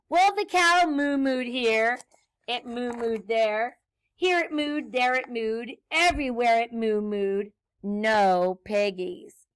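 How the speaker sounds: bouncy, rhythmic refrain narration; story read to children. A narrator's reading. Reader recites animal noises for children, bouncy storytelling. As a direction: read it with a nursery-rhyme bounce. Reads picture book, singsong refrain.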